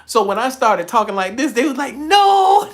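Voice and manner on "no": drawn-out high, loud cry of refusal; mock protest. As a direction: wail it out in exaggerated objection. Elders' objection acted out.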